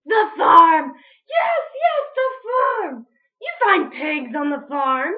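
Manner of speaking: high, loud thrilled cry; excited witch. Acted. Witch Grinch cheering her own idea, high and loud. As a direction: squeal with delight at the idea.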